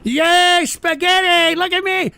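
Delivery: high, silly mock-childish cheer; mock childish glee. Mocking a rival streamer as a gleeful child, high and silly. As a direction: squeal it like a gleeful kid.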